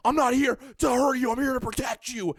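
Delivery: high, loud reenacted plea; exasperated yelling. Man retells reassuring cat before carrier, yelling. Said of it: "Me yelling at the cat".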